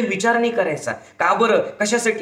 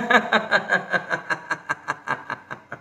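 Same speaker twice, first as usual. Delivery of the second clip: forced staccato laugh, fading out; deliberate laugh; 5 dB quieter than usual. Shown as a demonstration. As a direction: laugh on purpose until the breath runs out. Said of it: "तरी हसत राहा". (मुद्दाम केलेलं लांब हास्य)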